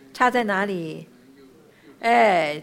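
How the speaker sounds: normal talking voice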